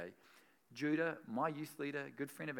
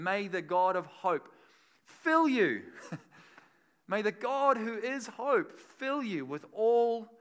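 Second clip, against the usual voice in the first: slow, much louder and higher, drawn out; soaring proclamation; 6 semitones higher, 12 dB louder, slower than usual. Acted. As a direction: lift the verse like a shout of blessing. Climax of scripture reading.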